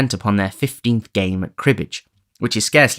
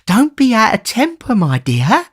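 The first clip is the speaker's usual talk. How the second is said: put-on much higher, pleading old man; 14 semitones higher, 5 dB louder than usual. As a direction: soothe him meekly.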